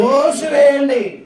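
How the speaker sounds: declaimed, long-held syllables; drawn-out command. Acted. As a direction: stretch the order out from the pulpit. Declaiming the quoted divine command.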